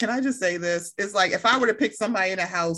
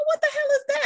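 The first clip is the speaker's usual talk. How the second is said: high-pitched sports outburst. Retold or imitated. Acting him watching a play.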